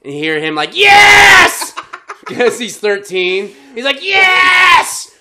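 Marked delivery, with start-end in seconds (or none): 0.8-1.7 high, loud yes; triumphant shout. Retold or imitated. Lookout friend overhearing the couple, cheering shout. 4.0-5.1 high, loud yes; triumphant shout. Retold or imitated. Lookout friend overhearing the couple, cheering shout.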